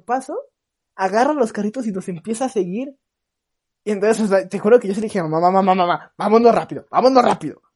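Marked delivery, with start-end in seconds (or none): 5.2-7.6 urgent, louder pleading to mom; panicked urging. Retold or imitated. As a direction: beg her to hurry, scared. Kid urging mom to flee.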